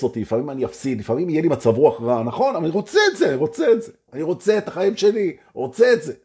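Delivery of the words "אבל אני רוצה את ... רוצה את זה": high, insistent want; eager affirmation. Acted. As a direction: claim it with excitement.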